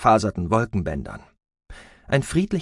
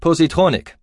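louder, higher call to the computer; raised voice; 7 semitones higher, 6 dB louder than usual. A narrator's reading. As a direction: raise the voice to summon it. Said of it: "Rhodan erhob seine Stimme".

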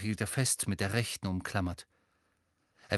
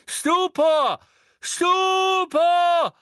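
much higher, very loud spell cry; shouted spell; 9 dB louder, much slower than usual. A narrator's reading. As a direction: fire the spell with a full-throated yell. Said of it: "rief Neville erneut". (laut geschrienener Zauberspruch, viel höher)

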